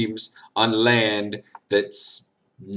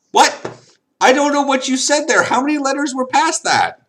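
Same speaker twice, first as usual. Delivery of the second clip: mock bafflement; 14 semitones higher, 5 dB louder than usual. Confused reaction to mock French.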